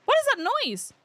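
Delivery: high, alarmed questions; startled. Real, not acted. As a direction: jump at the sudden noise. Startled by a noise in game.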